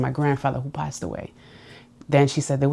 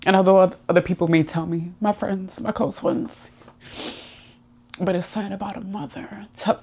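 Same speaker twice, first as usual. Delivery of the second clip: fighting back tears, pitch raised and strained; tearful; 5 semitones higher than usual. Real, not acted. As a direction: speak through real tears. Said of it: "I'ma cry".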